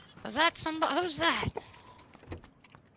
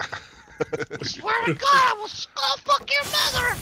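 mock threatening yell; 10 semitones higher than usual. Yelling at enemies.

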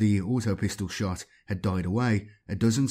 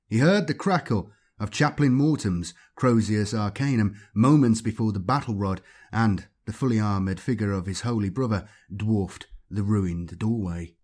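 plain reading voice

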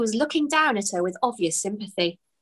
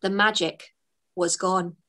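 plain reading voice

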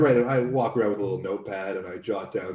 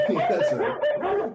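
high wheezy laugh through the words; 4 dB louder, much slower than usual. Real, not acted. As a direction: cackle at your own joke. Laughs at own joke.

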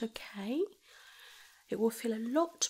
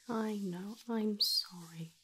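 ordinary delivery

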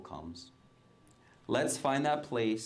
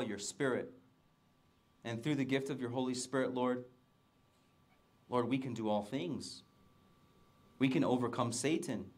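soft, measured prayer with pauses; 6 dB quieter than usual. Real, not acted. Pastor praying to the Lord for the congregation, hushed prayer. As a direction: pray softly, phrase by phrase.

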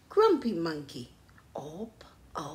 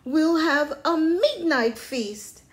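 loud, high excited character voice; excited storybook chimp; eager planning; 6 semitones higher, 8 dB louder, slower than usual. A narrator's reading. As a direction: list the fun plans with relish. Jim eagerly lists sleepover plans.

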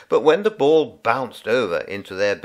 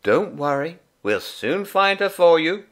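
reassuring helper; 8 semitones higher, 3 dB louder than usual. A narrator's reading. Helper comforting crying boy.

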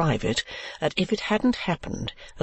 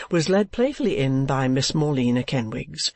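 ordinary delivery, nothing marked

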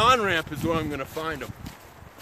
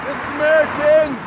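calling out; 7 semitones higher, 5 dB louder than usual. Real, not acted. Hitchhiker calling out to cars.

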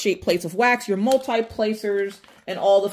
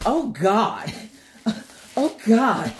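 ordinary talk

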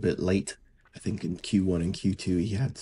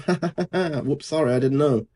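higher-pitched laughing fake sorry; mock apology; 7 semitones higher than usual. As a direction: imitate a driver's gleeful, insincere oops. Voices driver's fake apology after gaining.

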